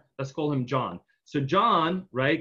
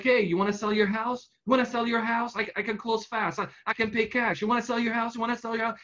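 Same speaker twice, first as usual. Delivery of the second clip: pushy cold caller; 8 semitones higher, faster than usual. Retold or imitated. Cold callers pestering John.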